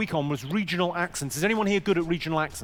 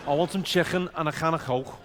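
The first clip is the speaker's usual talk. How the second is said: throaty comic Scouse; hammed-up Scouse catchphrase; Scouse accent. Shown as a demonstration. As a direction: demonstrate Scouse with scraped K sounds. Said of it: "let's make the Scousers feel at home".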